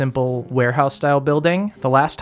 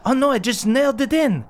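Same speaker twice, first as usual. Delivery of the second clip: put-on high teen dwarf voice; dwarf girl voice; 10 semitones higher, faster than usual. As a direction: chirp back in a high voice. NPC dwarf replies.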